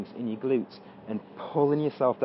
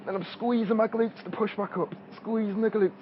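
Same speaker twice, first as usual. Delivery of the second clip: straining with effort; 7 semitones higher than usual. Real, not acted. Talking while pushing up from squat.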